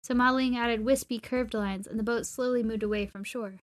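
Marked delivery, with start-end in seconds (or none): none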